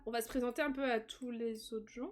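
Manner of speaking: ordinary delivery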